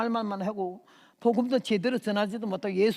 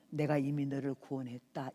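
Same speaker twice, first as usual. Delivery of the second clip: gentle Lord; 7 semitones lower, 6 dB quieter than usual. Retold or imitated. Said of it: "천천히".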